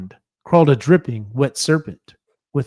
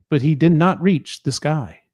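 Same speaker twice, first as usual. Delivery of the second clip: ordinary delivery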